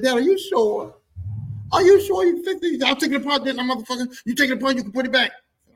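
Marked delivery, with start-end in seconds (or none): 0.0-2.8 much higher, louder, pleading doubt; doubtful shock. Retold or imitated. Doubting son questions dad. 2.8-5.3 higher and louder irritated dad; dad snapping back. Retold or imitated. Dad snaps back at son.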